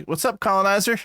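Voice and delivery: lightly voiced movie character; Hopper's character. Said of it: "Hopper's character was like".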